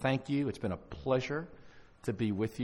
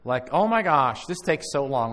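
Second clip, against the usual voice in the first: loud, higher-pitched impatient whine; acted impatience; 5 semitones higher, 8 dB louder than usual. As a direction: complain like you can't wait any longer. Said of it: "I get impatient".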